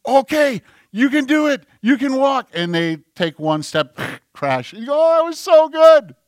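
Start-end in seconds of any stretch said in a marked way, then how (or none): 0.0-2.5 put-on high, bright-eyed baby-coaxing voice; cheering on. Parent teaching a child to walk, excitedly cheering. 4.9-6.1 very high, loud parental delight; gushing praise. Acted. Parent to a toddler who just took a step, gushing.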